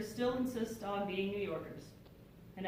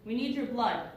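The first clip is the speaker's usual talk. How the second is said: nurse's raised-voice appeal, acted; loud request; 3 semitones higher, 6 dB louder than usual. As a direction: call out to a waiting crowd. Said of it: "made a loud request".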